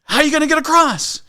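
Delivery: high, loud demanding question; questioning ranger. Retold or imitated. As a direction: shout the challenge across the river. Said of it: "questioning everything".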